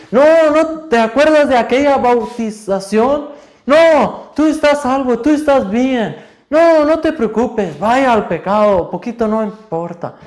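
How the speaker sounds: hammed-up devil egging them on; Satan's tempting voice. Satan telling the unsaved they are fine, loud and mocking. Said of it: "Y Satanás va a decir".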